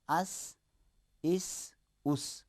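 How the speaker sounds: اس with a soft, thin s; quiet, slow اس with a light s; light seen sound. Shown as a demonstration. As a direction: let اس hiss lightly, held slowly. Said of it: "سین اگر تلفظ کریں گے".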